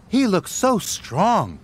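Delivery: put-on awed young engine; eager puff. A narrator's reading. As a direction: gush over the crane in awe. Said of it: "puffed Thomas".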